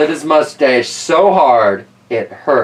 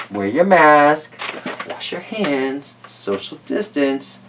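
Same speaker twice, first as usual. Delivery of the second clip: slow clear reminder; 4 dB quieter, slower than usual. Real, not acted. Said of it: "closing health advice".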